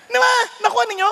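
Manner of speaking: ordinary talk, nothing marked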